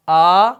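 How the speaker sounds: آ کی آواز; vowel aa demo. Shown as a demonstration. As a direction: sound out آ as a full aa. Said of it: "یہ پہلی آواز کیا ہے".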